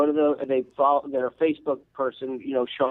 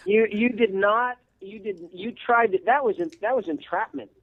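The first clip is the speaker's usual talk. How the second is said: playful mock outrage, pitched up; 6 semitones higher than usual. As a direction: tease them for tricking you. Mock accusation, laughing.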